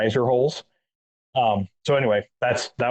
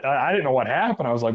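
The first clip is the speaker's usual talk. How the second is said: ordinary talk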